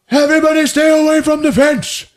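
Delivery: high, loud barked warning to the crowd; shouted countdown. Retold or imitated. Piiparinen warning villagers off the fence, loud high countdown. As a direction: call it out over the villagers. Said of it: "He's like".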